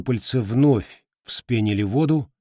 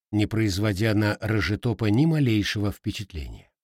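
usual speaking voice

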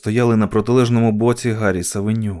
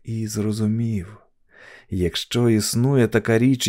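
ordinary delivery

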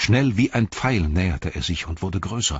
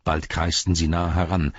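ordinary delivery, nothing marked

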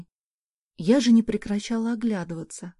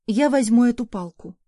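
threatening, louder and firm; menacing; 2 semitones higher, 6 dB louder than usual. A narrator's reading. Mother arming herself before the stranger, threatening. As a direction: sound ready to fight. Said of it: "грозно произнесла".

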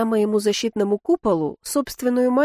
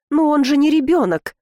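higher-pitched indignant protest; 6 semitones higher, 4 dB louder than usual. A narrator's reading. Karina scolding her husband for meddling, indignant. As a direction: object with mild outrage. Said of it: "возмутилась Карина".

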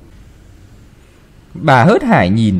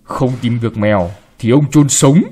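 threatening. Acted. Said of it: "ông trợn mắt nói".

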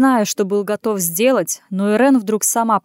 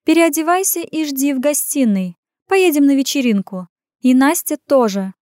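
higher, emphatic woman giving orders; commanding tone; 6 semitones higher, 4 dB louder than usual. A narrator's reading. Woman sending him to change for a party, imperious. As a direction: issue orders that allow no argument. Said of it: "повелительным тоном распорядилась".

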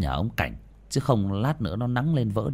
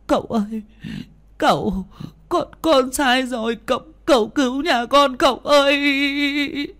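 much higher, sobbing, dragged-out begging; crying plea; 5 dB louder than usual. Acted. Kneeling wife begging the exorcist to save her family, sobbing. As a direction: beg for rescue through tears. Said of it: "liên tục van xin".

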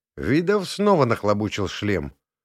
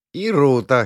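higher, louder, giggly; giggling; 4 semitones higher, 6 dB louder than usual. A narrator's reading. Inna teasing Oleg about Ruta, giggling. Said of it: "хихикнула Инна".

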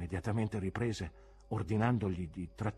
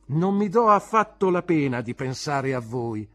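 usual speaking voice

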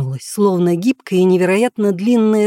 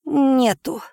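put-on little girl voice; 3 semitones higher, slower than usual. A narrator's reading.

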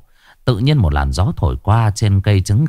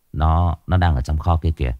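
ordinary delivery

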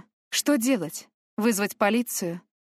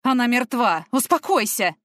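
louder, firm commanding shout; stern shout; 4 semitones higher, 6 dB louder than usual. Acted. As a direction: cut through her hysteria. Man shouting down hysterical girl.

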